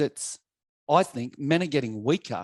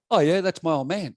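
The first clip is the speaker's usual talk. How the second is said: cocky; 5 semitones higher, 5 dB louder than usual. Retold or imitated. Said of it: "I must have got cocky".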